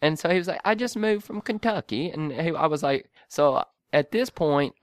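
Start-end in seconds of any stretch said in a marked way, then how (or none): 0.6-2.1 higher-pitched British caller; imitated British accent. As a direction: lightly mimic a British man. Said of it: "sort of a British accent".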